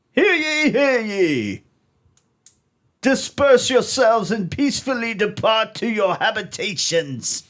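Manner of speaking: loud, high proclaiming crier voice; town crier. Acted. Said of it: "I like doing voices".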